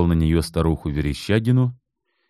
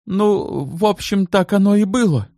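hesitant. A narrator's reading. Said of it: "замялся тот".

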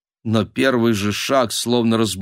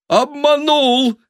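very high, loud, drawn-out cry of horror; horrified; 5 dB louder, slower than usual. A narrator's reading. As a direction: cry out aghast at the betrayal. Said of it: "ужаснулся Василий". (крик ужаса)

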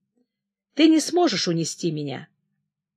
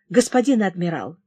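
ordinary delivery

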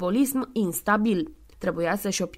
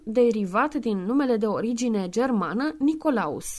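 ordinary delivery

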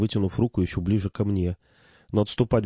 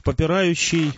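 higher, drawn-out grand word; solemn invocation; 8 semitones higher, slower than usual. A narrator's reading. Speaker retries his forgotten hymn to pharaoh, lofty and raised. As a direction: declaim it as a lofty title.

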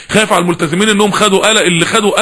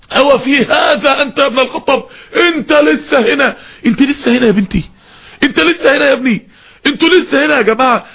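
impassioned shout; 5 semitones higher than usual. Acted. Preacher shouting the rebuke at audience.

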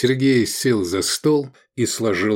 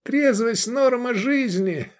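high, slow monotone chant; droning; 14 semitones higher, slower than usual. A narrator's reading. Hungover Edik reciting sobriety slogans, high drone. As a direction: drone the slogan on one note. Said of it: "бубнил Эдик".

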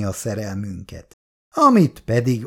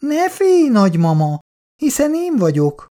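put-on high, louder, gleeful little-kid voice; giggling child; 12 semitones higher, 4 dB louder than usual. A narrator's reading. Grandson revealing himself to grandmother, giggly. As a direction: reassure her with childish delight. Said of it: "kacagott a gyerek".

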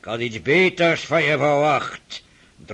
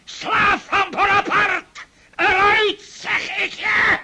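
much higher, screaming accusations; raging shout; 13 semitones higher, slower than usual. Acted. Old prince screaming at the French doctor to get out, raging shout. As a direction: scream him out of the house.

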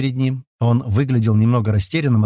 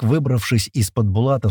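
plain reading voice

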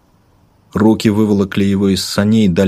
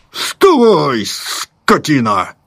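high barked order, slow on скотина; rough soldier shout; 8 semitones higher, much slower than usual. Acted. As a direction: yell at him to wake up. Soldier slaps him awake.

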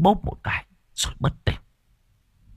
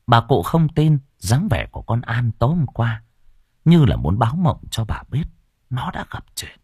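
plain reading voice

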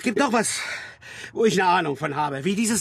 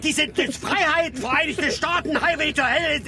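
high-pitched, worked-up rant; stumbling, overwrought tirade; impassioned outburst; 9 semitones higher than usual. Acted. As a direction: defend your idols as if nothing matters more. Acted impassioned defence of band.

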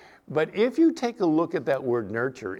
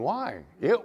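ordinary delivery

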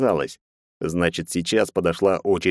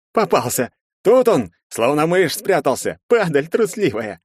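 high, loud gloating shouts; triumphant jeering; 9 semitones higher, 4 dB louder than usual. A narrator's reading. Pursuers find the cowering fugitive, jeering. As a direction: crow over the catch, high and loud.

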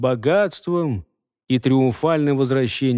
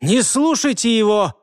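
shouting; 7 semitones higher than usual. Acted. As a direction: yell a warning. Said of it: "быстро прокричал на всю пещеру".

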